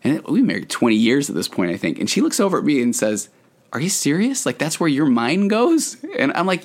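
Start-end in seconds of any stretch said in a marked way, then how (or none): 3.7-6.0 higher, slow disbelieving question; incredulous wife. Retold or imitated. Wife in the car, stunned by his thoughts, asks in slow high disbelief. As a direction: retell her stunned disbelief, drawn out.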